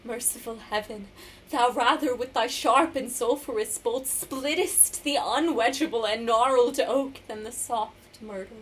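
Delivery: hushed appeal to heaven rising into forceful declamation; dramatic monologue. Acted. Isabella pleading for her brother's life, dramatic monologue. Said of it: "going to perform a monologue for us".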